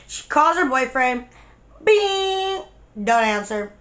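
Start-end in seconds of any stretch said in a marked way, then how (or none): 1.8-2.6 high, held ringing bing; imitated phone ring. Friend calling her boyfriend, imitated ringing. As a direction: sing out a phone tone.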